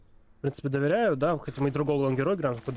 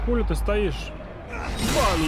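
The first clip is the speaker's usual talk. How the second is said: urgent, raised, frantic; panicking gamer; 6 semitones higher, much slower than usual. Real, not acted. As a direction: yell in a rush at the screen. Gamer panics at stuck teammate.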